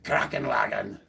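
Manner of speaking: mock German, punched out; hammed-up German word; German accent. Shown as a demonstration. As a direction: do a joking, over-the-top German accent.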